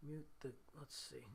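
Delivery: ordinary delivery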